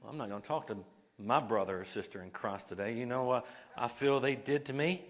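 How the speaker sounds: low, soft sulky mutter of a pouting churchgoer; mock sulky church member grumbling about a brother. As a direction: sulk it under your breath, mocking the pouter. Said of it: "We're not to walk around pouting".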